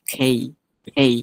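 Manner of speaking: "খেই" with k turned to an airy kh; aspirated K. Shown as a demonstration. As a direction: puff of breath into "খেই". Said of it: "কটা খর মতো করে".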